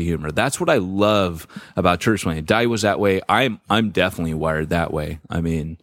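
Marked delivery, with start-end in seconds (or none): none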